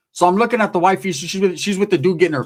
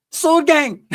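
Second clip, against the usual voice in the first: gang shout-out; 9 semitones higher than usual. Real, not acted.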